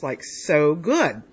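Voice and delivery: drawn-out, slow praise; slow savoring. Real, not acted. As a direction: stretch it out, savoring. Savoring the ribs.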